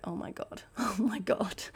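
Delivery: whispered with a small laugh; embarrassed whisper. Retold or imitated. Rider after crashing, whispering embarrassed. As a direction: breathe it out, mortified.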